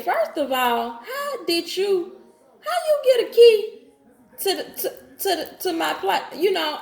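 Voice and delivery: high-pitched, half-laughing indignation; retold incredulous confrontation. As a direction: re-enact calling him out in disbelief. Acted confrontation about the key.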